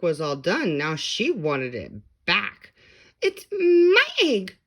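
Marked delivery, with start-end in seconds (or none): 3.2-4.6 high, loud, slow whiny cry; sputtered. A narrator's reading. Maisie demanding her egg, high crying wail. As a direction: wail it like a spoiled bird. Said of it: "she sputtered".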